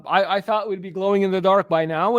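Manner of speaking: ordinary talk, nothing marked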